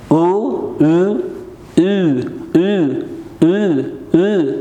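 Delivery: "او" very slow, moving towards a tight-lipped ü; stretched-out "او" shading into German ü; pronunciation drill. Shown as a demonstration. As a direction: repeat "او" as long separate vowels, lips drawn ever closer.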